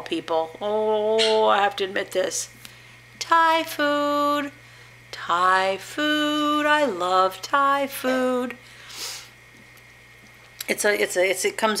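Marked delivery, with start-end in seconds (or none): 3.2-8.6 higher, long drawn-out words; slow singsong food love; indulgent craving. Real, not acted. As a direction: savor each word, stretched and high. Confessing a favourite food craving.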